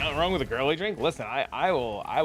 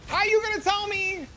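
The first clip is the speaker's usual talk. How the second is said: much higher and louder, in disbelief; incredulous outburst; 4 dB louder than usual. Real, not acted. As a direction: shoot the line up high, like you can't believe it. Incredulous reply to chat.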